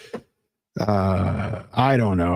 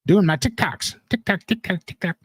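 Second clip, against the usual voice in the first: Mini Jess voice; 6 semitones higher than usual. Pretend. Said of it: "here's Mini Jess".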